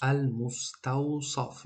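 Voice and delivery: very slow "Ал-муставсаф", syllable by syllable; "Ал-муставсаф" spelled out slowly for learners; careful sod pronunciation. Shown as a demonstration. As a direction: draw out Ал-муставсаф with a heavy emphatic s in саф. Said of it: "каме душворе талаффуз карданаш".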